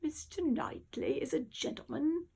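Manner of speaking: slow, whispered, lightly put-on posh upper-class English; a hint of a hushed upper-crust Brit. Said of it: "But the upper".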